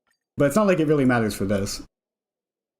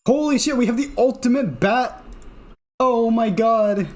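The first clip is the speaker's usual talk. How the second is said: high, elated outburst; excited; 8 semitones higher than usual. Real, not acted. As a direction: shout it out in delight. Getting the ultimate bat.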